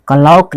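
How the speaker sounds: क्लॉक with a long "au", "ka-lauk"; wrong pronunciation. Shown as a demonstration. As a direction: draw the vowel of क्लॉक out to "ao". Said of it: "क्लॉक नहीं पढ़ेंगे". (क्लॉक में "औ" खींचकर बोलें)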